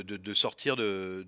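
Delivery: ordinary talk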